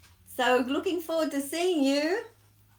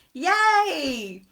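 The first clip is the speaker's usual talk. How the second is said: excited; 5 semitones higher, 8 dB louder than usual. Real, not acted. Cheering at end of song.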